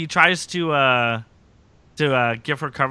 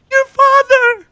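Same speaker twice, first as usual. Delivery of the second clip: very high, loud falsetto cry; hammed-up soap-opera revelation; mock dramatic reveal; 12 dB louder, much slower than usual. Host mimics captive naming the culprit, mock dramatic.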